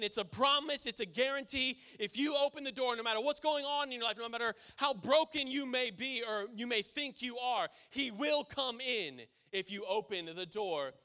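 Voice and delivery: building sermon intensity; fervent preaching. Acted. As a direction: drive home the promise. Preaching at full force.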